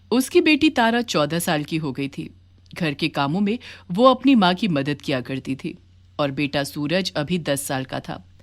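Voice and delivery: usual speaking voice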